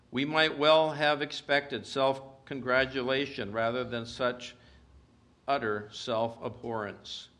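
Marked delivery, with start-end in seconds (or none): none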